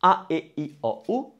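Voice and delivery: "A, E, I, O, U" as separate Italian vowels; five-vowel drill. Shown as a demonstration. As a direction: recite "A, E, I, O, U" as pure open sounds. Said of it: "take all the five vowels".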